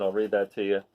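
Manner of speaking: ordinary delivery, nothing marked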